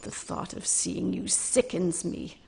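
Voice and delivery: harsh whispered scorn, voice barely voiced; hissed contempt. Mergil rejecting Kreia after slapping him, furious whisper.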